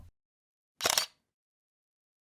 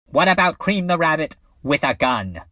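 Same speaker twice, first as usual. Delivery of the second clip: louder, higher burst of inspiration; excited pitch; 6 semitones higher, 6 dB louder than usual. Acted. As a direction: announce your absurd brainwave with force. Acting out a sudden idea.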